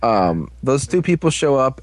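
ordinary talk

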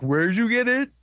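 high-pitched, rising puzzled question; mock clueless question. Girl who didn't hear the answer six times, imitated cluelessness. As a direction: ask it high, as if you missed every answer.

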